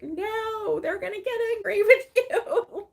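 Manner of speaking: very high, loud wail breaking into laughter; hammed-up alarm; mock worried protest. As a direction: imitate a fretting dad, amused. Retells dad's worried protest, laughing.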